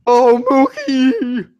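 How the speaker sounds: high, slow, drawn-out cry; mock moaning yell. Co-host mocks yelling a player's name during sex, hammed-up cry. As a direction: stretch the name out in exaggerated ecstasy. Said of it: "you can't yell like the players".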